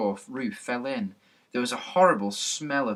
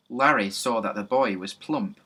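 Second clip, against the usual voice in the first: ordinary delivery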